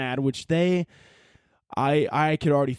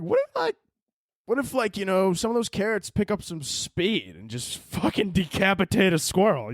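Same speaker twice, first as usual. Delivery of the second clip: high worried what-if; 6 semitones higher than usual. Retold or imitated. Imagining carrots from planes, raised mock-worried voice. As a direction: pitch jumps way up, half-joking alarm. Retold own thought, high voice.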